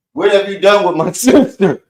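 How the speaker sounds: hammed-up demand in a movie voice; mock melodrama. Joking that Nequa is an evil twin, mock-dramatic. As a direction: play the betrayed brother.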